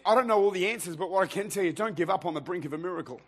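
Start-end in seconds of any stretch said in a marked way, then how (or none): none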